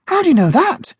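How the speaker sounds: high, loud wide-eyed puppy voice; impressed young puppy. Acted. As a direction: sound amazed by the clever friend. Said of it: "Bounce asked, impressed".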